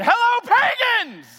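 high, loud, brash pulpit greeting; mock shouted greeting. Pastor greeting his church with an insult, mock shouted. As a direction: bellow it at the congregation like a provocation. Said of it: "if I walked up on a stage one Sunday morning and I said".

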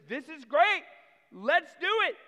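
a king thrilled with the idea; gleeful king. Acted. King cheerfully agrees.

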